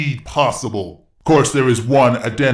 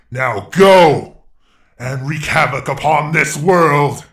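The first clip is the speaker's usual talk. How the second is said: loud, higher battle command; commanding shout; 5 semitones higher, 7 dB louder than usual. Acted. Eggman unleashing Super on the world, commanding shout. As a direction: send him off with a roar. Villain commanding his minion.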